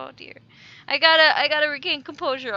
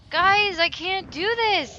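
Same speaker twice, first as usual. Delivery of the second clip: loud, slow, panicked wail; begging the audience in fear; panicked plea; 5 semitones higher, 6 dB louder, slower than usual. Real, not acted. Scared player pleading with viewers, panicked.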